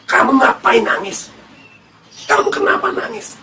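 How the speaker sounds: airy, lifted questioning; angel questioning. Acted. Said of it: "kedua malaikat itu bertanya".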